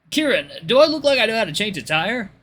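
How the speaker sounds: high, loud incredulous retort; incredulous Luke. Acted. Luke to Kieran about changing a tire, incredulous.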